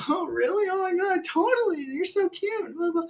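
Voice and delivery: squeaky falsetto gushing; hammed-up girly delight, very high; mock gushing girl. Girls thrilled by his offer of a ride, mocking falsetto.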